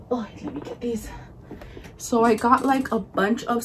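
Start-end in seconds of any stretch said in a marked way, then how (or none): 0.1-1.1 whispering. Real, not acted. Whispered aside while fetching items.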